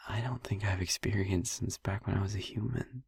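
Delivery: a hushed, intimate near-whisper; soft whisper. Acted. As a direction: keep it close and hushed, opening up. Tender confession continues.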